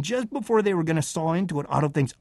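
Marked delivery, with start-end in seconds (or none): none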